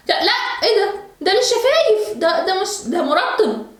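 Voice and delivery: high, half-laughing mom scolding; exasperated mom scolding. Real, not acted. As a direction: scold her in shock, laughing. Daughter ate lip balm.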